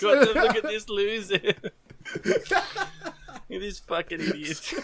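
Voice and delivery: high-pitched imitated jeer, breaking into laughs; mocking through laughter. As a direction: jeer in mock scorn while cracking up. Mocking onlookers' words amid laughter.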